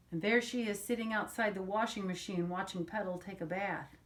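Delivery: ordinary delivery, nothing marked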